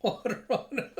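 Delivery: breaking up mid-sentence; real laughter. Real, not acted. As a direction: lose it laughing on the last words. Narrator cracks up at scene.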